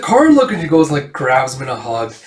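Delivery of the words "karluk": high, loud emotional call; acted cry, pitched right up; crying out a name. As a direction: greet him with a rush of relief. Woman cries out to returning Karluk.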